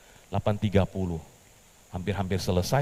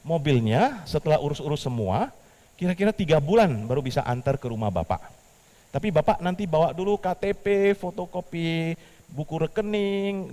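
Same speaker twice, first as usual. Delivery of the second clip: higher, louder pushy salesman; eager caller; 8 semitones higher, 4 dB louder than usual. Acted. The bank caller giving Petrus the delivery terms, eager. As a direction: press the details eagerly.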